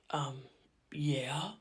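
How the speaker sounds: breathy awkward whispered yeah. Retold or imitated. Caught off guard by grandma, a whispered yeah. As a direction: whisper it, embarrassed.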